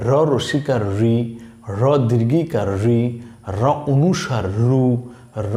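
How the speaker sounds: ordinary talk, nothing marked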